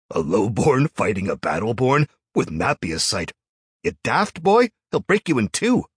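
loud, high scornful jeering; mocking. A narrator's reading. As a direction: jeer at the boy. Crowd mocking Jarl.

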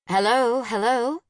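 insistent phone hello, rising at the end; calling out. A narrator's reading. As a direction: call down the line to check someone is there. Dispatcher calls from forgotten receiver.

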